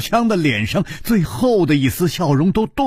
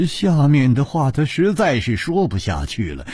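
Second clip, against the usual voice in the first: plain reading voice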